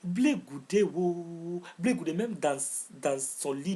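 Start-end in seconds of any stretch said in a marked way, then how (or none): none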